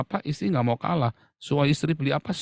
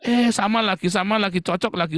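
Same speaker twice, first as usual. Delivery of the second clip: giddy couple; 5 semitones higher, 5 dB louder than usual. Retold or imitated. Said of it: "Bukan harus yang selalu kompak".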